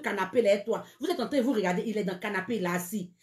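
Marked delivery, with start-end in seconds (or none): none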